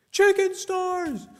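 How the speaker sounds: high, loud yelled chicken stars; yelled catchphrase. Real, not acted. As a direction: shout it out while fleeing. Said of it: "I clearly yelled".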